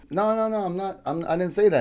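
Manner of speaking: retold defensive no-no-no; hasty denial. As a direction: rush to take it back. Retold denial to offended customer.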